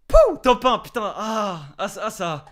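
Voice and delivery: loud high whoop; victory cheer. Real, not acted. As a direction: yell it out. Another victory.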